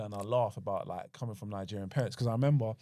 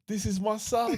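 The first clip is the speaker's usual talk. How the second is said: high, proud Jamaican mum voice; 11 semitones higher, 6 dB louder than usual. Retold or imitated. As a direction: boast like a proud mother.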